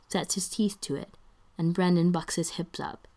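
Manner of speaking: ordinary delivery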